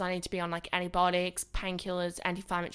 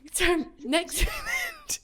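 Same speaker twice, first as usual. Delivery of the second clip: slow words broken by laughter; can't get through it for laughing; 9 semitones higher, much slower than usual. Real, not acted. Laughing while telling story.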